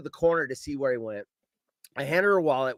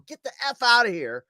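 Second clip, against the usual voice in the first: raised, louder dismissal; higher, forceful mock command; retold angry order; 5 semitones higher, 4 dB louder than usual. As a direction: send the thief packing, re-enacted. Retold ordering the thief away.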